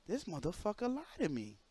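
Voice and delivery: quiet retold anger at being lied to; muttered fury. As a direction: say it low to yourself, stunned. Retold private fury at boss.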